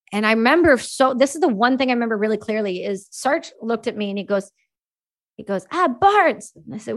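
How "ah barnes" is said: higher, drawn-out "Ah" of a concerned sergeant; sergeant's voice. Retold or imitated. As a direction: voice the French-Canadian sergeant calling her. French-Canadian sergeant quoted.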